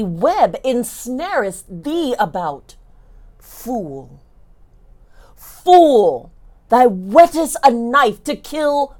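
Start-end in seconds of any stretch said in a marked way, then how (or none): 3.4-4.2 low muttered scorn. Acted. Margaret's scornful curse, first take. 5.3-6.3 loud, falling cry of contempt; hurled insult of contempt; scornful cry. Acted. Margaret's curse, repeated louder.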